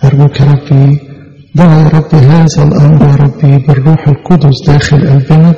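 usual speaking voice

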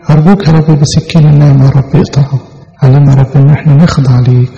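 usual speaking voice

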